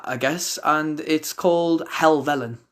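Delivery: ordinary delivery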